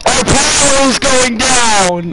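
high, drawn-out panicked call; hammed-up panic, pitched high; played-up alarm. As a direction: cry out the bad news, slowly stretched. Game power running out, alarm.

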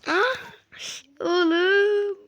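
high, loud shocked yell into laugh. Real, not acted. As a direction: scream out then laugh. (yüksek sesli şaşkın çığlık ve kahkaha)

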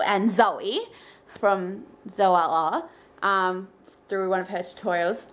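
ordinary delivery

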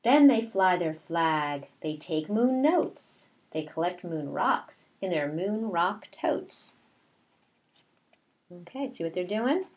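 slow, sing-song read-aloud; reading to children. A narrator's reading. Teacher reading a picture book to her class, slow sing-song. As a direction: read the rhyme slowly to the class.

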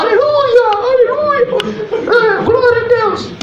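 hammed-up falsetto praise; mocking the church sisters. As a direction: mimic the sisters shouting aleluia in a put-on falsetto. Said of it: "E as irmãs lá".

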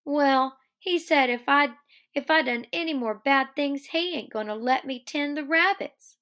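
put-on high, childlike worried explaining; Lennie miserable. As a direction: pitch it up as a woeful simple man.

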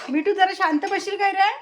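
high, loud plea to the parrot; exasperated call to a pet. Real, not acted. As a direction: raise your voice to hush the bird. Asking parrot to be quiet.

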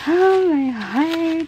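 slow, high, breathless oh my heart; real rush of emotion at the gift; overwhelmed gasp. Real, not acted. Unboxing a gift from Faye, overwhelmed and gasping.

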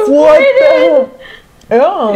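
high squealing laugh. Real, not acted. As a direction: crack up mid-sentence. Cracking up at marble.

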